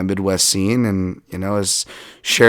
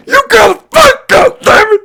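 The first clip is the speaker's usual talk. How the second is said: high, much louder screaming father; yelling dad; 10 dB louder than usual. Retold or imitated. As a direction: scream at him from the corner like an angry dad. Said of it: "you just hear his dad go".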